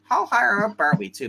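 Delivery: ordinary talk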